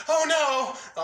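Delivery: high, loud mock dismay; cartoon cry. Trying to place a Flapjack voice, cartoonish wail.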